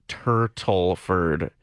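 TURTLE FURRED drawn out slowly, each syllable separated; over-enunciated correction on "turtle furred"; careful enunciation. Shown as a demonstration. Corrects pronunciation of the name.

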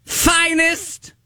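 high, slow, grand proclaiming voice; town crier voice. Acted. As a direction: draw each word out like a herald. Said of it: "in the town crier voice".

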